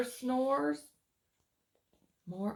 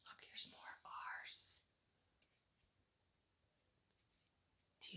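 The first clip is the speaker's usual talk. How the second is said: whisper; 22 dB quieter than usual. Real, not acted. Whispered aside to child listeners.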